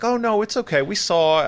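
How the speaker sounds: lightly voiced polite mother; reassuring parent. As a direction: brush it off like a gracious mom. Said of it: "and they're like".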